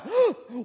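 sharp, high acted gasp; crowd gasp. Onlooker reacting to the healing, startled gasp. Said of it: "he hears gasps from the crowd".

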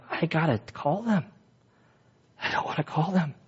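soft, dreading self-talk; hushed reenacted reluctance; reluctant muttering. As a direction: say it under your breath, not wanting to. Retells dreading calling the IRS.

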